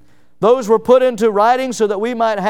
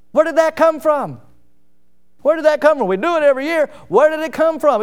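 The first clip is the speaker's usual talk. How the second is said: higher-pitched puzzled questioning; acted puzzlement; 6 semitones higher than usual. Family member questions a yearly tradition, acted puzzlement. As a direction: wonder aloud, pitch lifted.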